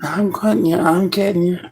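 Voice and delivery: high mock threat; the cat threatens in a high put-on voice; voicing the cat. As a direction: squeal it like an angry kitten. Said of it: "she's so".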